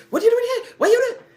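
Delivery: high, loud shocked outburst. Retold or imitated. The husband finds his wife waiting, high and loud in shock. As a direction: caught out, blurt it high and loud.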